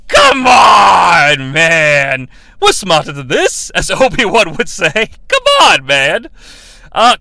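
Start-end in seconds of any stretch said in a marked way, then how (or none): 0.1-2.3 loud, fed-up call; exasperated shout. Acted. 5.3-6.3 very high-pitched, loud whine; squeaky exasperation. Acted. Catchphrase repeated, higher.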